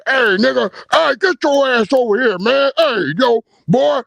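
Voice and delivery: mock a loudmouth barking orders; loud tough talk. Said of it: "talked all loud like that".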